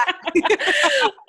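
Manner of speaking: a high, delighted burst of laughter; bright laugh. Real, not acted. Guest laughing at the host's salt-and-pepper joke, high delighted laugh.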